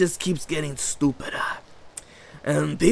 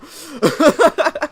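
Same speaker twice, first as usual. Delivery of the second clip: high cackle; 9 dB louder than usual. Real, not acted. Laugh after mock bit.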